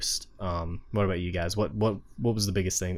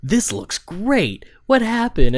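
high, drawn-out delighted disbelief, imitating everyone; amazed crowd reaction; 12 semitones higher, 7 dB louder, slower than usual. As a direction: jump up high and louder in mock astonishment. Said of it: "now everybody's like".

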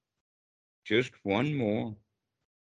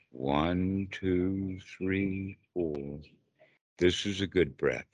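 slow, low, soft breathing count; guided meditation; 7 semitones lower, 3 dB quieter, slower than usual. Acted. Teacher guiding a student to relax with breath, calm meditation voice. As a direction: settle into calm with long, even numbers.

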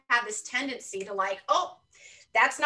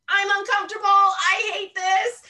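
exasperated complaint; 9 semitones higher, 8 dB louder than usual. Retold or imitated. Venting to the audience about daily discomfort, exasperated and much louder. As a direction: let it burst out, fed up.

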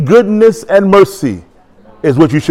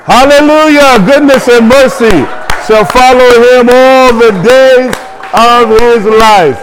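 calling across the sanctuary; 6 semitones higher than usual. Real, not acted. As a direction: shout directions across the room. Shouting over running demo.

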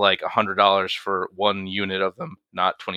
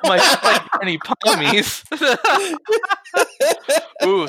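laughing; 3 dB louder, slower than usual. Real, not acted. Joking about his drink.

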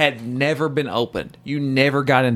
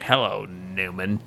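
Seinfeld's Newman greeting; 6 semitones lower, 6 dB quieter than usual. Retold or imitated. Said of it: "I sounded like Emperor Palpatine".

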